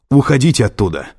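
urgent, high-pitched yell; loud alarmed order. A narrator's reading. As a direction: shout a warning down the phone. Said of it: "заорал Лэнгдон".

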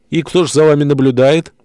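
bored duty sergeant humouring a crank; lightly voiced weary desk sergeant; sergeant on the phone. A narrator's reading. (скучающий дежурный сержант)

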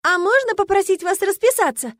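lightly voiced eager fan girl. A narrator's reading. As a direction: blurt out the request, pitched high. Eager fan asks for autograph.

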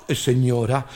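a low, servile "señora"; waiter. Retold or imitated. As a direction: waiter answering the old lady. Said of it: "waiter answers".

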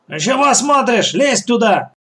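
high-pitched bossy scolding; impatient order. A narrator's reading. Gang boss sends son into cellar, impatient scolding. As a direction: snap at him to get moving.

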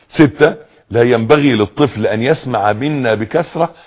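usual speaking voice

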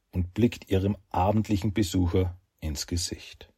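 ordinary delivery

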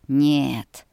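low, firm refusal; a touch of a beast's growl, pitched low; lightly growled, deep no; beastly growl. A narrator's reading. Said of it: "Рык зверя заставил сжаться".

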